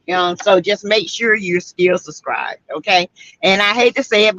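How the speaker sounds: ordinary talk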